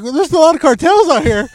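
high, loud mimic of the warning; hammed-up mimic; mocking the host. Mimicked version.

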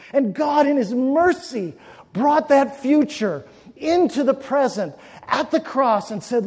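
loud, high, shouted warning sermon; fiery preaching. Acted. Preacher warning fools who deny God, loud and shouted. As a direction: hammer home the judgment.

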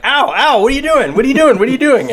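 high, loud frantic pleading; pained librarian. Retold or imitated. Librarian tased in the arm, high panicked protest. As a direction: squeal it like someone being tased. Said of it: "she's just kind of like".

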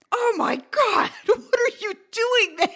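high, loud, laughing astonishment; shocked and laughing. Retold or imitated. Manager hearing his drummer on the phone, loud laughing shock. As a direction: blown away by the coincidence.

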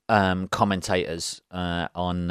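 usual speaking voice

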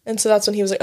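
usual speaking voice

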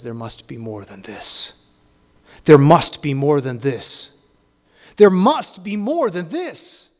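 insistent plea, slowly swelling; insistent inner voice. Acted. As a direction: start low and measured, build to a loud, emphatic cry. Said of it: "that little voice that says".

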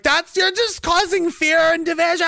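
high, slow accusing whine; mock indignant whine. Mocking critics accusing him of fearmongering, high slow whine. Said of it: "And like".

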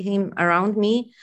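normal talking voice

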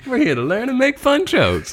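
high, bright show-opener voice; cheesy episode-opening announcer voice; announcer intro. Pretend. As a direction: sell it like a podcast intro line. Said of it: "what we should say at the beginning".

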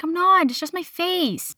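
mock-defensive, drawing out the end; retold protest. Retold denial to manager.

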